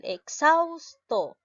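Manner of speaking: Exhausto drawn out slowly, syllable xaus leaned on hard; slow stressed demo. Shown as a demonstration. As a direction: stretch Exhausto and hit xaus. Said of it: "la mayor fuerza de voz lleva en la sílaba".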